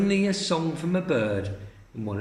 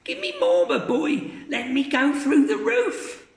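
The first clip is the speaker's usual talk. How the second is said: put-on screechy old voice; 13 semitones higher, 3 dB louder than usual. Grandma calling from the attic, very high screech. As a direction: screech it from above.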